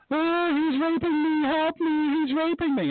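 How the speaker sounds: high, shrill-pitched mock shriek for help, hammed up; mocking falsetto scream of a woman in a restroom. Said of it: "And she starts screaming".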